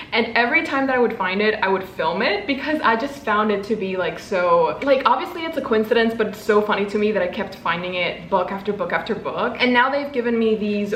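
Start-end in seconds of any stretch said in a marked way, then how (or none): none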